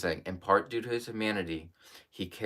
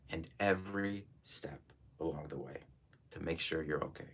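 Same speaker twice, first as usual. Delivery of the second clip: fading to a whisper; 4 semitones lower, 10 dB quieter than usual. Retold or imitated. Comforting daughter, fading to whisper.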